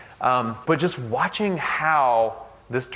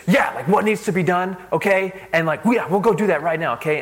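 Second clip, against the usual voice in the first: higher, louder, brisk go-getter voice; intense intern; 7 semitones higher, 4 dB louder than usual. Retold or imitated. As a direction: play the intense intern who gets it done. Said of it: "she's pretty intense".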